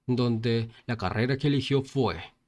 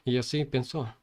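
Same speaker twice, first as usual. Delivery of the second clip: plain reading voice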